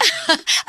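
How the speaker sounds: high, bright happy chuckle of a grandmother; happy laugh. Acted. Grandmother laughing happily with her graduate grandson.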